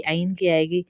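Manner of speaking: normal talking voice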